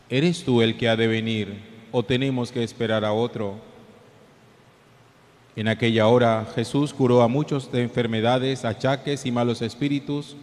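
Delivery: solemn gospel reading, echoing; cathedral echo. Real, not acted.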